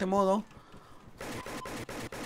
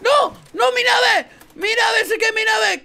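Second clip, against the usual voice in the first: high panicked yells; panicked shouting; 9 semitones higher, 4 dB louder, slower than usual. Real, not acted. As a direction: shout in alarm. His plane is going down.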